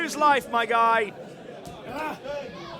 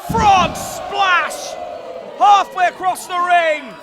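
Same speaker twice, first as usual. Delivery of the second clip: higher, loud shouted call; excited shout; 5 semitones higher, 7 dB louder, slower than usual. Real, not acted. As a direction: yell it like the crowd just exploded. Play-by-play of big move.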